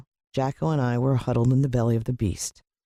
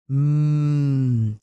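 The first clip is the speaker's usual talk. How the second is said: savoring mmm; 2 semitones higher, 4 dB louder than usual. Acted. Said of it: "as he tasted the sauce".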